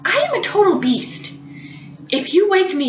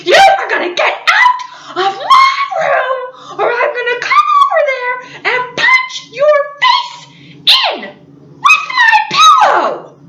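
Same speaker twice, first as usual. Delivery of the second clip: much higher, louder, half-laughing yell; laughing scream; 13 semitones higher, 5 dB louder than usual. Retold or imitated. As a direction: scream the threat but crack up at the end. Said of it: "I started screaming and I said".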